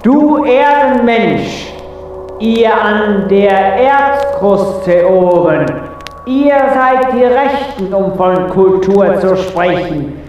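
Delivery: high, scornful theatrical tirade; indignant scolding. Acted. Indignant ruler berates the human. (empörte, theatralische Standpauke)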